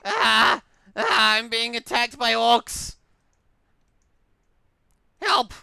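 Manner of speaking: loud high playful panic yelling; mock panic. Real, not acted. Gamer attacked by orcs, loud playful panic. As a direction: shout for help, hamming up the ambush.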